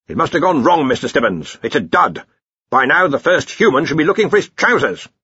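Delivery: lightly blustering Arch-Chancellor; Ridcully. Acted. Arch-Chancellor tells Ponder the universe is faulty, mildly impatient. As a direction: dismiss it with mild impatience.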